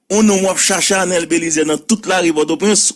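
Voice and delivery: ordinary delivery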